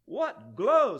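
high, loud outraged question; half-scream it in disbelief; screamed demand. A narrator's reading. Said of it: "screamed the ruler".

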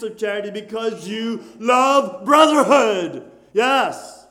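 full-force preaching, loud and fervent; loud, fervent pulpit preaching. Acted. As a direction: preach it out to the congregation like a revival. Preacher exhorting the congregation.

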